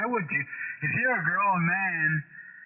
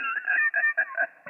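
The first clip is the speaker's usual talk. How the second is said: a very high squeal of a laugh. Real, not acted. Amused by the radio squabble, high squealing laugh.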